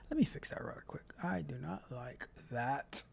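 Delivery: very soft, half-whispered aside; muttered aside. Real, not acted. Host fixes the screen mid-show, muttering to himself. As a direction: talk under your breath to yourself. Aside while fixing the stream.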